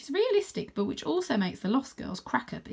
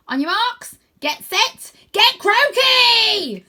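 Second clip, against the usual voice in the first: high, loud shout, last word drawn out slowly; race start cry; 14 semitones higher, 12 dB louder, much slower than usual. Acted. Stella starting the race for the team, shouting. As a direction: start the race with a rallying yell. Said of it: "Stella cries".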